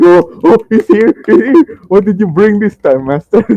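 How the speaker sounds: high, loud, eager chatter; excited orcs. Acted. Orcs crowd round master asking for gifts, excited chatter. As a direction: fawn over the master like a thrilled minion. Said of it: "some of them are, like, clapping very, very fast".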